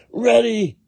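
put-on high, loud, slow child's shout; excited shout. A narrator's reading. Jack shouts to his mother, high and loud. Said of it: "shouted Jack".

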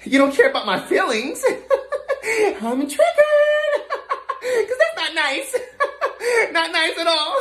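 high voice breaking into manic laughter; hammed-up hysterical giggle; unhinged giggling. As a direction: laugh through it, high and menacing. Unhinged clerk reacts to insult.

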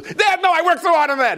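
high, loud pleading cry; desperate plea. Retold or imitated. Son begs father not to tear his wages, desperate plea. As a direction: beg him to stop.

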